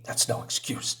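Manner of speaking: breathy hushed retort; whispered reply in the argument. Acted. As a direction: hiss it under the breath. Character retort, unvoiced.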